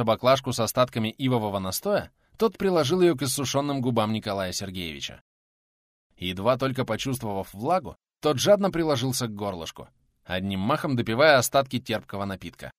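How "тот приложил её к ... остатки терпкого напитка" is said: ordinary delivery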